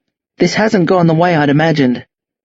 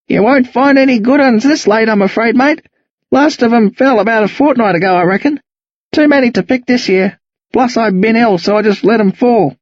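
put-on easygoing old Aussie, chatty; old Aussie bloke; 6 semitones higher than usual. Old Australian neighbour Jack talks.

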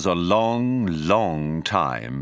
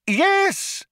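very high, drawn exasperated yes; impatient; 3 dB louder than usual. A narrator's reading. Loonquall confirms the question once more, exasperated. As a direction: cry it out at wits' end.

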